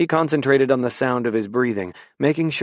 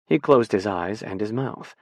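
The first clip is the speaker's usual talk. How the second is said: plain reading voice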